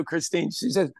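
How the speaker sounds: normal talking voice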